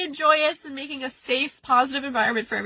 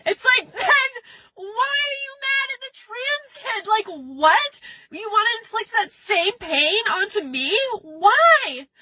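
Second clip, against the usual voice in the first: tearful outrage; 7 semitones higher than usual. Real, not acted. Crying rant over coworker.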